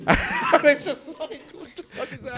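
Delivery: high, laughing disbelief; cracking up mid-sentence. Real, not acted.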